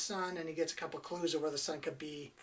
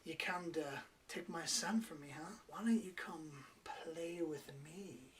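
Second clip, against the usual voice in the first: seductive switch; 9 dB quieter than usual. Retold or imitated. A mock seductive whisper, slowed down. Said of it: "she switches gears with him".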